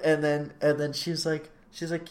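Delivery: normal talking voice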